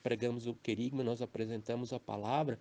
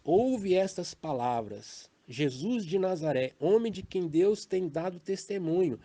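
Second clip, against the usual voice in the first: proclaiming scripture; 5 semitones higher, 4 dB louder than usual. Acted. Quoting Peter's speech.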